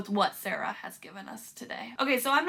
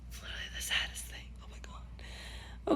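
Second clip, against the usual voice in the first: much softer, whispered and teary; broken whisper; 8 dB quieter than usual. Real, not acted. Reader whispers to viewers, overwhelmed by grief. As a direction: barely get it out.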